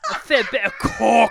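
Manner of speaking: laughing, high, loud British echo; mock British accent. Echoing the friend's joke, laughing mock British shout. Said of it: "making fun of accents".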